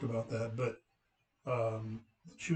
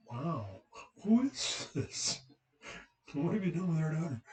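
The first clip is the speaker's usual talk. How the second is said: high, incredulous squeak on the question; stunned family voice, played for laughs; mock amazement; 5 semitones higher than usual. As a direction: gawk in disbelief, half laughing. Family reacting amazed to daughter's accent.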